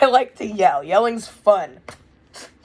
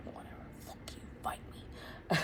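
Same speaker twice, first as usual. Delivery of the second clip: whispered aside; 20 dB quieter than usual. Acted. Muttered defiant aside.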